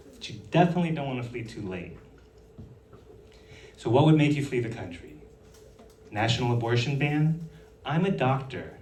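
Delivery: ordinary talk, nothing marked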